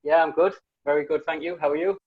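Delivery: usual speaking voice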